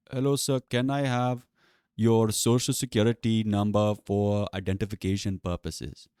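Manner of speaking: put-on slow, formal Indian-accented request. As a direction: imitate an Indian agent reading his script, accent and all. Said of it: "racist Indian accent".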